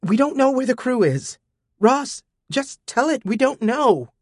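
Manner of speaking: high, pleading rush of panic; frantic. A narrator's reading. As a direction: Elytra frantically begging Ross to answer. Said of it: "Elytra said frantically".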